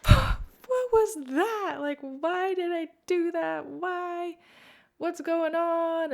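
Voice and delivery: high, loud, drawn-out wail; distraught self-questioning. Retold or imitated. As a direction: reenact a meltdown, wailing each question. Acted frantic self-questioning in car.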